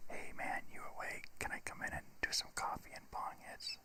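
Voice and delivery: hushed early-morning whisper. Retold or imitated. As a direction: whisper through the door. Friend knocking at the door.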